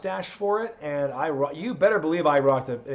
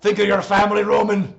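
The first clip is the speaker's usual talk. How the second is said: loud, raised Scottish wrestler promo; Drew McIntyre; 4 semitones higher, 5 dB louder than usual. Acted. Wrestler challenging his rival for WrestleMania, Drew McIntyre impression. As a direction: bellow a challenge in a Scottish accent. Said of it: "I do a pretty good Drew McIntyre".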